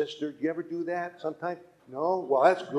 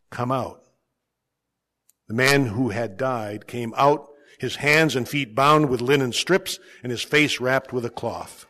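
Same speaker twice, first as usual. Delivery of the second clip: measured, solemn scripture reading; gospel reading; 4 semitones lower, 5 dB louder than usual. Acted. Lector reads the gospel to the congregation, solemn. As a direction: read the lesson to the congregation.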